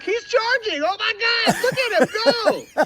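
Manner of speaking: very high, hammed-up crowd cheering him on; mock awe. Onlookers cheering the naive guy's charge, mock awe. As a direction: marvel in a comically high-pitched voice. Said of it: "And everyone's like".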